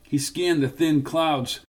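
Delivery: ordinary delivery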